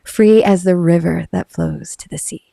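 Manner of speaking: corrected closing line, read low and unhurried; low slow ad read. Shown as a demonstration. Fixing the ad's last line, low slow voiceover. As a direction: let the last line settle low and calm. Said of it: "Is that right".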